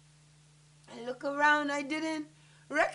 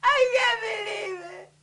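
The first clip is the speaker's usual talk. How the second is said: high, loud anguished wail; distraught cry; 5 semitones higher, 4 dB louder than usual. Acted. Daughter unable to reach her mom, distraught cry. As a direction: cry it out, overwhelmed with disbelief. Distraught after waking to disaster.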